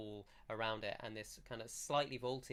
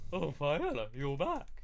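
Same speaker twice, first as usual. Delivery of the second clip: loud, raised greeting from a big simple fellow; gangly blacksmith; 4 semitones higher, 6 dB louder than usual. Acted. Blacksmith greeting Viola on her return, loud character voice. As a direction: hail a returning friend in a put-on voice. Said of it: "he comes and goes".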